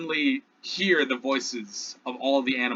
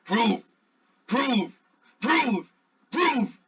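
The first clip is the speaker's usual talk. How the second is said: rising demand; 6 semitones higher, much slower than usual. Acted. Triceratops demanding proof.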